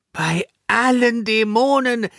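high, drawn-out exasperated groan; pained, theatrical dismay. A narrator's reading. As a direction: moan as if this is the last straw. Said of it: "stöhnte Sanja".

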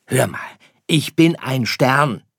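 high, loud, deliberate protest; little star setting the fox straight; insistent little star. Acted. (beharrlicher kleiner Stern)

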